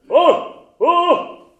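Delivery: high, loud, slow shouted "おう"; shouted vocal drill. Shown as a demonstration. Coach demonstrates the shout to the baritones, high and loud. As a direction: bark it out like a warm-up shout. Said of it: "発声練習みたいな".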